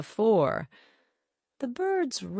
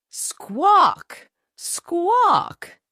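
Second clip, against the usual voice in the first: high, loud hen squawks; shocked squawking; 11 semitones higher, 7 dB louder than usual. A narrator's reading. Hen squawks back in shock at the news. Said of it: "cried Hen in return".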